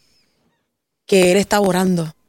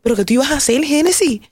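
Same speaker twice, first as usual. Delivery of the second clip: self-reproach; 6 semitones higher than usual. Retold or imitated. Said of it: "Y yo decía".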